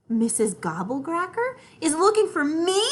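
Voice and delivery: high, loud amazed question; amazement. Acted. Little sister amazed she is being hunted, high and loud. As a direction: blurt it out amazed. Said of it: "I say in amazement".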